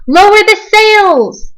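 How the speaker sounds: high, loud, slow storm order; captain bellowing to his crew in a storm; shouted. A narrator's reading. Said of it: "Braidbeard shouted".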